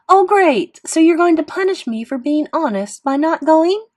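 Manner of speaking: loud, high indignant protest; yelled. A narrator's reading. Sister yelling at Mom about being punished, indignant. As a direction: yell it with angry sarcasm. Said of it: "Via yelled at Mom".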